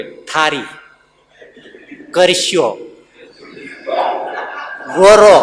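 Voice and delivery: put-on rural regional accent, ળ said as ર in ગોરો. Preacher mimics villagers of a region who say ર for ળ. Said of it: "અળ ન બોલી શકે".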